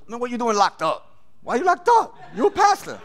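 high, rising disbelief with a laugh; mock the pastor for being locked up; incredulous laugh. Inmate laughing at a pastor in jail, incredulous.

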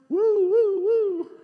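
high, loud mock police siren wail; siren imitation. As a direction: wail like a cop car right behind them.

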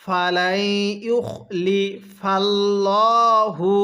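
ইউখলিফাল্লাহু with a thick, heavy ল; chanted ইউখলিফাল্লাহু, the ল made thick; heavy l recitation. Shown as a demonstration. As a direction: recite ইউখলিফাল্লাহু with the ল full and dark. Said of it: "এই লামটা কিন্তু মোটা করে পড়তে হবে".

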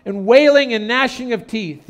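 high, booming preacher's yell. Retold or imitated. Country preacher warning his congregation about hell, yelled out. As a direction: thunder it to the back pews. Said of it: "he yelled out to the congregation".

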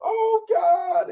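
put-on very high breathy girly squeal; flustered girl's squeal. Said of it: "she's like".

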